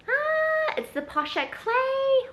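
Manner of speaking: high, drawn-out excited squeal; real gleeful high-pitched unveiling; excited reveal. Real, not acted. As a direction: squeal the big reveal, loud and stretched. Reveals the giveaway gift.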